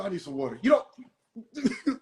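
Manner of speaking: normal talking voice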